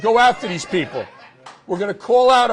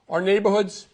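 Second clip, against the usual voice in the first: ordinary talk, nothing marked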